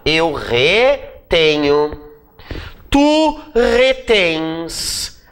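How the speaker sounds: very slow, syllables stretched; slow conjugation, word by word; drawn-out dictation. Shown as a demonstration. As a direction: stretch each word as if writing it out. Writing out the conjugation on board.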